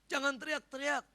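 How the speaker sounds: loud, high acted scolding; scolding crowd. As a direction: tell off someone making a racket. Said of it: "Artinya gini".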